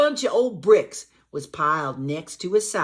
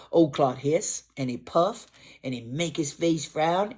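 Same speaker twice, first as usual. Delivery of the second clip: ordinary delivery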